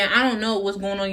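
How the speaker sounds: ordinary talk, nothing marked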